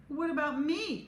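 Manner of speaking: sobbing. A narrator's reading. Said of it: "Chloe sobbed".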